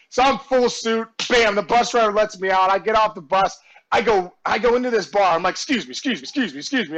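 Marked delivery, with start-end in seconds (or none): 5.5-7.0 low, soft, repeated excuse-me; muttered pushing through. Retold or imitated. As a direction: squeeze through a crowd, excusing yourself under your breath. Acts out squeezing through a crowded bar.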